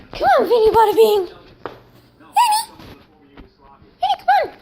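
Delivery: very high sing-song pet call; talking to a dog. Real, not acted. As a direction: coo at the pet in falsetto. Calling the pet sweetly.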